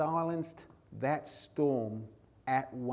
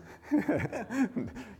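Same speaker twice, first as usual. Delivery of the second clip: a quick amused laugh; real laugh; short laugh; 9 semitones higher than usual. Real, not acted. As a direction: laugh at the joke on Mitch. Laughs about Mitch stealing sermon.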